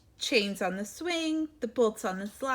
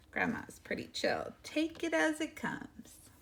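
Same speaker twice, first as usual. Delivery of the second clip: soft unvoiced aside to the listener; whispered aside; 6 semitones higher, 6 dB quieter than usual. A narrator's reading. Reader confiding to the children about Grandma. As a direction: lean in and whisper it.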